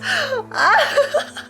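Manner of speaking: very high squeal of laughter; squeal-laugh as if it's too much; overwhelmed squealing laugh. Real, not acted. Streamer can't handle the cuteness, squealing laugh.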